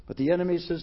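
ordinary talk, nothing marked

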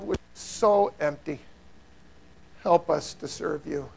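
soft, low closing prayer; quiet prayer. Acted. Preacher praying over the congregation, soft and low. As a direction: pray it humbly, hushed.

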